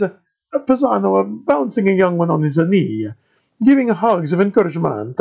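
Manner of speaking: put-on high, loud thick Italian accent of a friar; Italian-accented padre greeting a stranger. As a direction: play the monk in a heavy Italian accent. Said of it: "the thickest Italian accent I'd ever heard".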